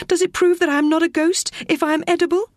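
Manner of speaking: put-on a frightened girl half-asking herself; young girl Teo. Narrator voices Teo, a girl.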